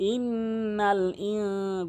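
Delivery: very slow, N hummed through the nose; long nasal hum on "ইন"; nasal ghunnah. Shown as a demonstration. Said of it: "গুন্না করতে হবে".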